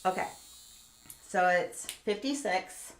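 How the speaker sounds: ordinary talk, nothing marked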